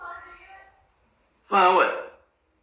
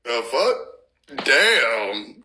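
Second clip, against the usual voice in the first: normal talking voice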